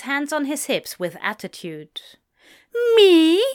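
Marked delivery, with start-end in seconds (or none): 3.0-3.4 loud, high, indignant disbelief; incredulous. A narrator's reading. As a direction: squeak it out in disbelief. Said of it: "asked incredulously".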